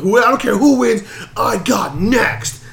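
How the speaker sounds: high, loud, imitated wrestler outburst; mock loud demand. Imitating the Miz claiming the next title shot, mock loud demand. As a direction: throw it out loudly, drawing out the last claim. Repeats the Miz's demand, acted.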